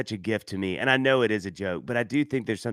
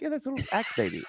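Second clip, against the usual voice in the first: higher, faster offhand reply; breezy high answer; 12 semitones higher, faster than usual. Retold or imitated. Explaining the family quirk to the spouse, high and breezy. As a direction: toss it off lightly, pitched up.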